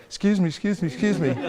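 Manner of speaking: eager, pushy repeated excuse me with a laugh; pestering salesman calling excuse me; hyper pushy. Shown as a demonstration. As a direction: chase after a stranger, too keen. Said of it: "don't get all hyper".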